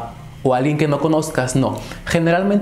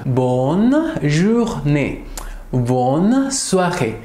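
Bonne journée drawn out very slowly; very slow careful Bonne journée; slow demo; much slower than usual. Shown as a demonstration. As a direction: stretch it for learners to copy. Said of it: "decimos bon".

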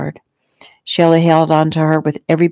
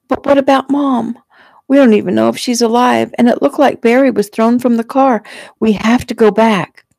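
higher, agitated protest; distraught pleading; 7 semitones higher than usual. A narrator's reading. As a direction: plead urgently to turn back. Girl pleads to turn back.

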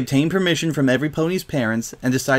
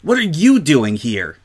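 higher, louder blurt; blurted question; 5 semitones higher, 5 dB louder than usual. A narrator's reading. Scootaloo can't control herself at the unexpected guest, blurting. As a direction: blurt it out, pitched up. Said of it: "she blurted".

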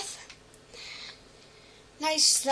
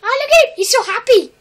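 very high, loud cooing; excited cooing; 10 semitones higher, 13 dB louder than usual. Real, not acted. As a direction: gush at the happy little one. Cooing at baby animal.

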